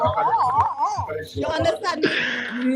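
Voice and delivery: laughing through the words, slow; chuckling as she asks. Real, not acted. A teacher laughing at the story to parents, slow. Laughing after retold scream.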